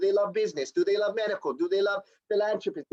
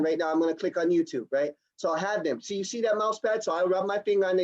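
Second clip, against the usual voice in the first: ordinary talk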